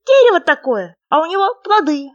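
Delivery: high, loud, squeaky little frog; small creature's high, loud voice. A narrator's reading. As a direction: pipe up eagerly as a tiny frog. Frog explains mango.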